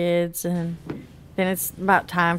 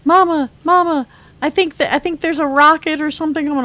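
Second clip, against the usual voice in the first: put-on child's alarm; 7 semitones higher, 4 dB louder, faster than usual. Child alarmed, retold to mother.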